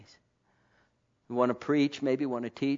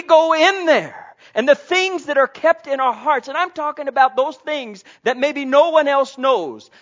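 loud, high preaching climb; preaching at full force; 12 semitones higher, 11 dB louder than usual. Acted. Preacher rousing the congregation, loud and high. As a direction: lift the congregation with fervent preaching.

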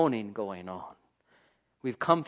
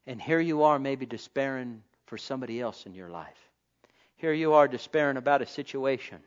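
usual speaking voice

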